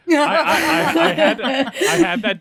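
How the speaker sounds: high, laughing confession. Real, not acted. Player admits he planned the joke, laughing. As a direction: stammer it out through laughter, speeding up.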